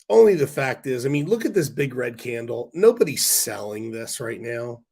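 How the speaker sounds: usual speaking voice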